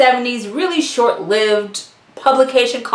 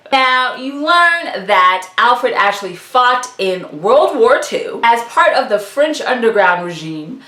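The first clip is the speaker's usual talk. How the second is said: normal talking voice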